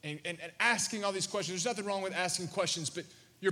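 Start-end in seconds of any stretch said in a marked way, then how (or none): none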